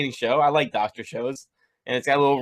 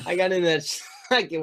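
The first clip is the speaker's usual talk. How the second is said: laughing; 6 semitones higher than usual. Real, not acted. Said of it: "All right, composure".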